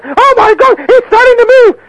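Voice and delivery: panicked aunt. Retold or imitated. Aunt voiced panicking over spider.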